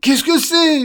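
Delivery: very high, loud startled question; terrified scream. Acted. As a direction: shriek it in fright. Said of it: "hurla Monsieur Peureux". (question hurlée, suraiguë)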